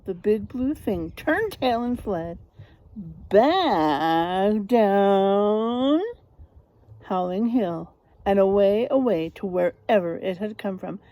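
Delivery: ordinary delivery, nothing marked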